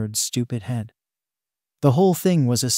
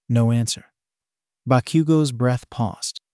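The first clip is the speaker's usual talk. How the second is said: plain reading voice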